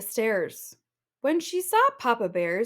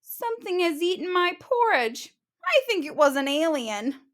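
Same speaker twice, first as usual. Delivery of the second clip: higher, a touch sad cub voice; mildly mournful small bear; sad little bear; 8 semitones higher than usual. Acted. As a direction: cub sadly looking at his bowl. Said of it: "looked sadly at his bowl".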